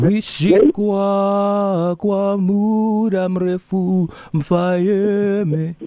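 Wishikwa kwa muda mrefu drawn out slow and high; each syllable of Wishikwa kwa muda mrefu stretched slowly; over enunciating. Shown as a demonstration. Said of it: "I'm gonna speak in a different language".

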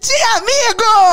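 loud, high burst on the punchline; sudden shout reveal. Acted. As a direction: blurt it out to make her jump. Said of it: "que susto!".

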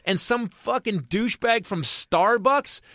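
high-pitched, drawn-out outrage; comic indignation, high and slow; incredulous rant. Retold or imitated. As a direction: stretch each phrase in exasperated disbelief. Rants in disbelief about the barista.

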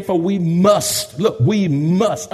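slow, heavy "must", each word laid down; stress on must. Shown as a demonstration. Preacher underlining "must" for the congregation, slow heavy stress. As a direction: lean hard on "must". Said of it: "underline must, that's emphatic".

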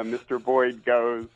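normal talking voice

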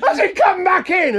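high, loud call from behind a door; shouted question. Retold or imitated. Wife calling from the bedroom about the bird, high and loud.

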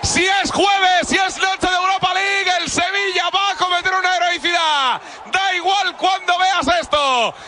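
impassioned shouted radio call; shouted commentary. Real, not acted. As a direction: hammer each da igual. Commentator celebrating equaliser.

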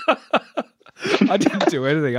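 slow, high, laughing through the words; laughing denial. Real, not acted. Guest laughs off the host's praise, laughing. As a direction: laugh it off while insisting.

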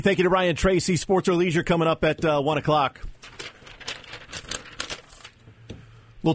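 upbeat station promo read; radio announcer. Acted. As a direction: sell the show like an announcer.

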